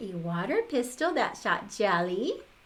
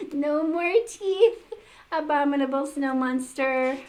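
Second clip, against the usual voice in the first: triumphant; 5 semitones higher, 5 dB louder than usual. Acted. Triumph over the monster.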